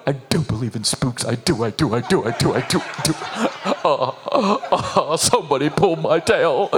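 low, hushed, frightened chanting; hammed-up trembling coward who whimpers; Cowardly Lion. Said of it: "are you praying like a cowardly lion?".

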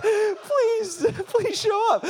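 high, laughing begging; mock desperate plea. Preacher begging God to back his promise, comically desperate. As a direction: plead high, half laughing. Retold desperate prayer for healing.

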